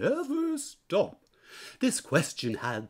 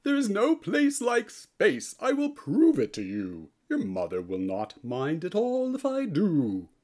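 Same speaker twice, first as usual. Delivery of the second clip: ordinary delivery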